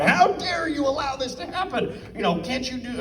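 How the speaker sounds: high-pitched mock fury; furious collector. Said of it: "collectors that were furious with us".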